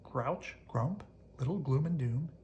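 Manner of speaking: ordinary delivery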